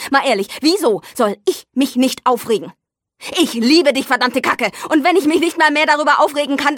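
working himself into a rage; aufgeregt. Acted. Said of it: "Jetzt reg dich doch nicht so auf". (wütend und immer lauter)

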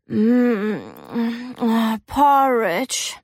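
slow, drawn-out relish; savouring. Acted. May smells breakfast on her way to the bath, savouring. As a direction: hum with pleasure, then draw the word out.

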